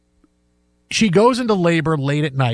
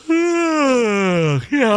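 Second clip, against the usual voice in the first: exaggerated yawn; 6 semitones higher than usual.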